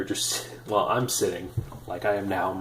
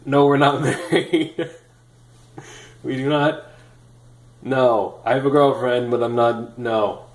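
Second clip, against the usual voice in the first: loud, laughing, hurried denial; louder retold protest breaking into a laugh; flustered protest; 3 semitones higher, 9 dB louder, slower than usual. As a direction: blurt it out, embarrassed and amused. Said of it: "And I said".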